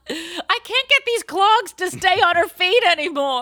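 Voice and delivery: high, loud, exasperated complaint; exasperated character. Pretend. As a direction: fuss over the clogs. Voices the in-laws struggling.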